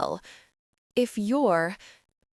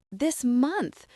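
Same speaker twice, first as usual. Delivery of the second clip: furious; 6 semitones higher than usual. A narrator's reading. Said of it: "I say furiously".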